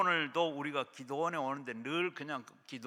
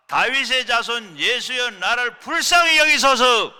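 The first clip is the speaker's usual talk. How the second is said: higher, much louder, slow desperate cry; full shout; 8 semitones higher, 14 dB louder than usual. Shown as a demonstration. Pastor leading the congregation in crying out to Jesus, all-out shout. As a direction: cry out with all your strength like the blind beggar. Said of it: "시작".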